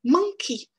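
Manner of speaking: "Monkey" with MON loud and high, key tiny; stress on MON. Shown as a demonstration. Teacher drilling the first-syllable stress of "Monkey". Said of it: "une première syllabe qui est plus forte".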